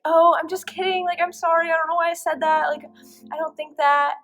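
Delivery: high, loud, gushing apology; imitated backtracking girlfriend. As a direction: imitate her hurriedly taking it all back. Girlfriend hastily takes back the breakup.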